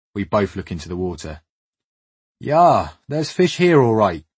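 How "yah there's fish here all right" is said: lightly voiced West African accent, higher; a hint of a West African accent, raised pitch. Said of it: "comes a thick West African accent".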